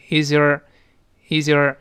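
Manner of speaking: “is your” run together as iz-yer; linked words. Shown as a demonstration. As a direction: link the z of “is” into a weak “your”. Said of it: "辅音碰到半元音会连读". (“is your”连读，z滑入弱读的your)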